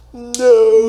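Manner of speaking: shrill high cry of no; high squeal. Acted. Both react to Joe Rogan.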